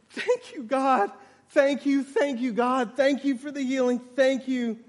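high, acted outpouring of gratitude; tearful thanks. Healed man praising God on his knees, acted tearful thanks. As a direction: play overwhelmed thanks, lightly tearful. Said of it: "he starts sobbing".

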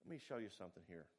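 a patient mentor easing you in; kindly mentor. Retold or imitated. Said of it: "and said kindly".

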